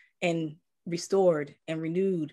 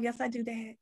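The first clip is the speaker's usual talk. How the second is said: soft yes; 5 semitones higher, 7 dB quieter than usual. Retold or imitated. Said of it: "I'm like".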